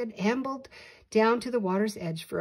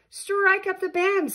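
grand call; 12 semitones higher, 7 dB louder, slower than usual. A narrator's reading.

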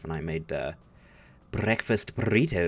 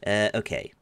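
usual speaking voice

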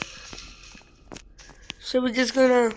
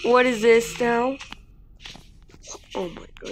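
ordinary delivery

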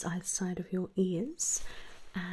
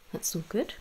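ordinary talk

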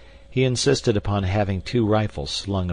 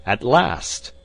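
higher exclamation, swelling on LAST; triumphant cry; 5 semitones higher, 3 dB louder than usual. A narrator's reading. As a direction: cry out as if finally there. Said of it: "he cried".

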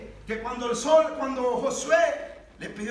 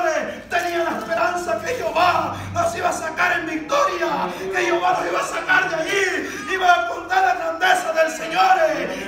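loud, high, fervent preaching; full-force preaching; 5 semitones higher, 8 dB louder than usual. Real, not acted. Preacher proclaims deliverance to the church, full-force preaching. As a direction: preach it up into a shout of praise.

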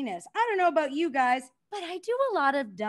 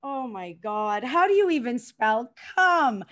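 ordinary talk, nothing marked

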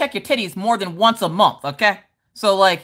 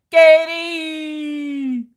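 calling out; 8 semitones higher than usual. Real, not acted. Calling a chatter back.

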